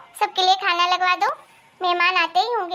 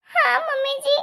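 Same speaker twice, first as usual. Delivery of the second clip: timid daughter-in-law; 6 semitones higher than usual. Acted. Timid bahu voice answering.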